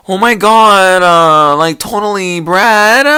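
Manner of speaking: put-on high, loud valley-girl gush. Imitating the blonde girl from the show, valley-girl gush. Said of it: "she's like".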